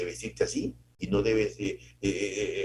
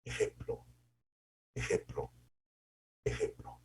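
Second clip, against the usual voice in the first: hushed emphasis; 8 dB quieter than usual. Acted.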